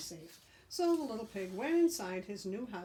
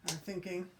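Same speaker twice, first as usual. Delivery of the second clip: plain reading voice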